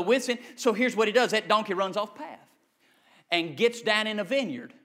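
ordinary talk

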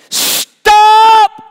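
high, loud sudden yell; child's cry. Retold or imitated. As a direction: shout stop like a startled little girl. Daughter cries out from car.

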